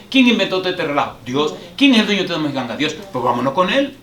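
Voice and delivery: loud, fast, high preacher's build; fiery preaching. Acted. Pastor to congregation, preaching at full force. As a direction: hammer each question and answer it.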